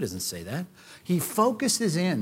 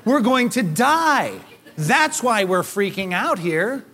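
exasperated retort; 5 semitones higher, 4 dB louder, slower than usual. Pretend. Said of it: "I'd slap my forehead and say".

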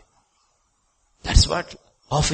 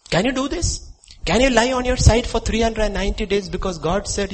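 ordinary delivery

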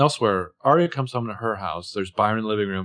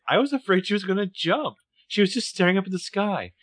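high, anxious mock-mother voice; worried mother; 9 semitones higher than usual. Mother confides her fear about her daughter on the roof, high and anxious. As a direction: pitch it up like a fretting mom.

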